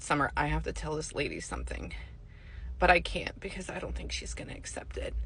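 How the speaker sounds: low, soft retold confiding; confidential aside. Psychic to her nail tech about a client, confidential. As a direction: drop the voice as if nobody else should hear.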